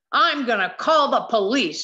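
loud, threatening bus driver. A narrator's reading. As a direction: warn her hard and loud. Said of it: "he threatened".

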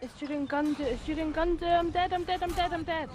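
high-pitched, repeating in rising panic; real in-game panic, pitch climbing; panicked babble. Real, not acted. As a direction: gabble it over and over as death closes in. Panicking as character dies.

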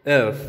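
"œuf" open rounded vowel; French œuf. Shown as a demonstration. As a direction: say "œuf" firmly.

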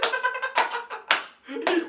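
high, loud burst of laughing; real laughter. Real, not acted. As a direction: crack up at your own story. Laughs at own birthday prank story. (tiz kahkaha)

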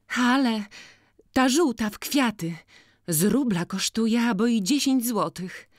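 put-on high, loud, bright-eyed girl complaining of the seller; lively village girl. Jagna asking Boryna to judge the cap, high and loud. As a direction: pitch it up and call out to Maciej.

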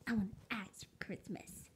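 a hint of Bubby, whispered; hushed, lightly voiced boy wanting an axe; imitating Bubby. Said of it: "that one right there was Bubby".

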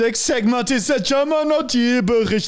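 high, hammed-up German game announcer; shout-sing it in a cartoon German accent; mock German accent. Host announcing the next segment, mock German accent.

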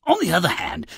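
normal talking voice

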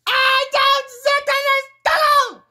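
high, slow wailing yell; drawn-out scream. Acted. Fed up with the noise, drawn-out scream.

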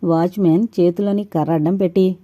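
plain reading voice

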